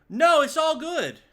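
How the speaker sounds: high, loud, slow mock proclamation; dramatic shout. Streamer hamming it up to chat, loud and slow. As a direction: belt it out like a grand announcement.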